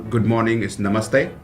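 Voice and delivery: normal talking voice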